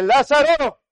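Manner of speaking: loud, high, commanding call; booming call to the dead man; voice of authority. Acted. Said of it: "con su voz de autoridad".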